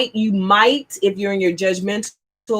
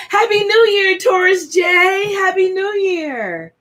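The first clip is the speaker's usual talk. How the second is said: joyful shouted greeting; 8 semitones higher than usual. Real, not acted. Greeting returning viewer joyfully.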